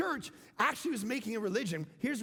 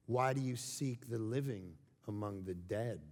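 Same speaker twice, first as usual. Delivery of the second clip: slow, lower, grand pronouncement; dramatic delivery; 11 semitones lower, 5 dB quieter than usual. Acted. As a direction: let the line land like a mic drop. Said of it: "It's like".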